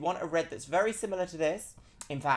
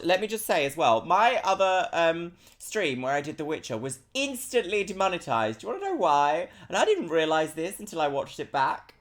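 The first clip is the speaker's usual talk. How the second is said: ordinary delivery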